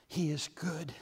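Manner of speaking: whispered He is good. Acted. Preacher whispering to the congregation. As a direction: breathe it without voice.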